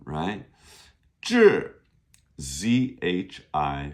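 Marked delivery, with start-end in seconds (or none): 1.2-1.8 志 starting high and falling sharply; fourth tone. Shown as a demonstration. Teacher modelling magazine's second syllable, falling fourth tone. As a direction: drop 志 hard from high pitch, louder. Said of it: "fourth tone".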